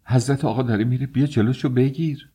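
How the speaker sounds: high, hurried plea; urgent calling. A narrator's reading. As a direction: call her over in a rush. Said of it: "او را صدا زد".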